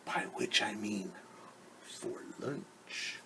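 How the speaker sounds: sinister hushed whisper. A narrator's reading. Wolf whispering his real plan to himself, hushed. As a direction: breathe it as a wicked secret. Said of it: "And then he whispered".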